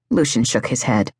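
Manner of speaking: usual speaking voice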